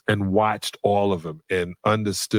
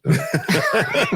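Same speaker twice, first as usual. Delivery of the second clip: high laugh; 13 semitones higher than usual. Real, not acted. Laughing at Kobe's reason.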